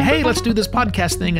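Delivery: ordinary delivery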